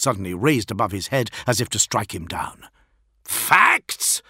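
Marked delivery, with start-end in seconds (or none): 3.2-4.2 high, loud snarl; snarled outburst. A narrator's reading. Fulgrim rounds on the envoy, snarling. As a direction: snarl the word back in disbelief. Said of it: "snarled Fulgrim".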